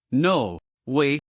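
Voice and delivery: cracking voice, arguing weakly. Acted. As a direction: let the voice crack as you protest. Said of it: "their voice cracking as they tried to argue".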